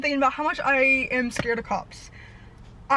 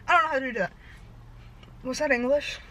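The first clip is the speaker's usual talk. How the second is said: normal talking voice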